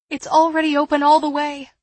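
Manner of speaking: quick, high, loud reply; urgent protest. A narrator's reading. Girl answering frantic woman about window, quick and loud.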